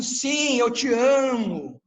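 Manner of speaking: "eu te amo" said slow and loud, close to a shout; emphatic yes. Shown as a demonstration. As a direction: insist hard on "eu te amo". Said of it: "É tipo um".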